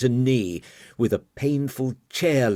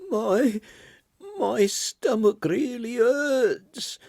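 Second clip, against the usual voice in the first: much higher, slow, weak whimper; 13 semitones higher, slower than usual. Acted. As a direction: whimper it in pain, slowly. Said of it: "gurgled Margot in a weak, heart-rending whimper".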